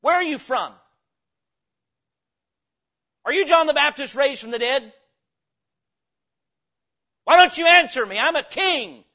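high, full-voiced angry shouting; Herod's angry demands. Herod demands that silent Jesus answer, shouting angrily. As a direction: bellow as a king being ignored.

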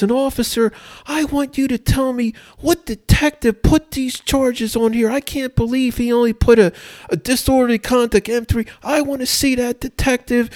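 very high-pitched, outraged judge impression; screaming judge. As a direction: imitate an irate judge berating a defendant. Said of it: "he starts screaming at the, the defendant".

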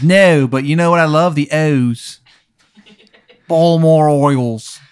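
put-on gargled, overdone Baltimore accent; gurgling Maryland accent. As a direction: swallow the vowels in a bad Maryland accent. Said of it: "It sounds like he's gurgling".

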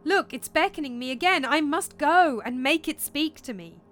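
high, loud and eager; excited, determined exclamation; urgent insistence. Acted. Hamlet insists on following ghost.

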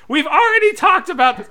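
high, loud squeaky diva voice; Miss Piggy voice. Retold or imitated. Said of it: "sorry for the Miss Piggy voice".

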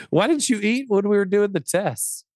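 ordinary talk

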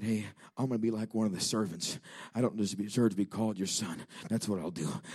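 low, soft acted resignation; humbled resolve. Prodigal son planning words to father, quiet and humbled.

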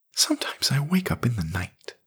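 put-on whispered confession of a scared boy. A narrator's reading. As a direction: hush it like a night secret.

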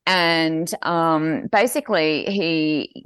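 usual speaking voice

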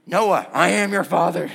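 loud, angry mock villain voice; forceful prank announcement from hiding; Darth Vader. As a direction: boom it out like an angry movie villain. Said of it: "I sound really angry".

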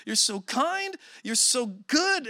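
high, slow, choked-up praise; a touch of tearful awe; retold weeping. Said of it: "And I'm weeping and I'm going like".

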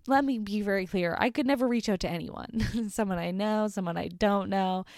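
ordinary delivery

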